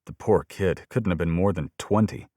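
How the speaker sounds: plain reading voice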